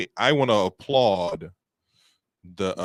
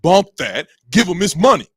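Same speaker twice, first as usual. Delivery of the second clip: mock loud outburst; 9 semitones higher, 11 dB louder than usual. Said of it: "I don't think anybody is like".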